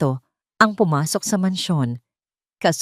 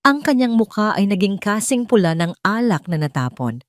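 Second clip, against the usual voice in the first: ordinary delivery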